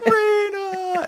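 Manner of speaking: very high, loud crying wail of Rina; mock wail. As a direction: wail her name in hammed-up grief. Anguished cry.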